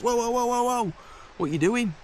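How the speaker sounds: high urgent whoa, stop him now; high alarmed whoas to stop the rock blow; alarmed warning. Retold or imitated. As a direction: call out in alarm to halt him. Stops boy hammering knife with rock.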